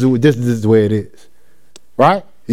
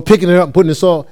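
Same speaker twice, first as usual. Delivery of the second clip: fast, tumbling repetition; rushed repeat; much faster than usual. Shown as a demonstration. Preacher mimicking restless replanting, fast. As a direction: rattle it off quickly.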